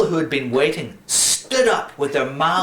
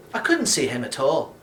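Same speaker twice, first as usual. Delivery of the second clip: ordinary delivery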